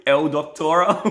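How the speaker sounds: high, loud, incredulous laugh-talk; cracking up in disbelief. Real, not acted. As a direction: blurt it out through laughter. Laughing at a silly name.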